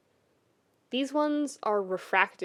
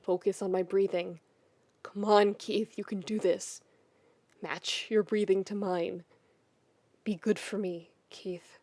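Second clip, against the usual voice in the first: soothing coaxing; 2 semitones lower, 4 dB quieter than usual. Acted. Shiro calming Keith, coaching his breathing softly. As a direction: coax him gently to breathe.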